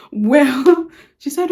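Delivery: normal talking voice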